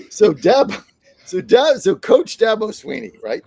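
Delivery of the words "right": ordinary talk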